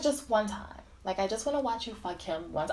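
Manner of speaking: soft imitation of her pleading; quiet coaxing. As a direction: coax softly, as if asking a secret favor.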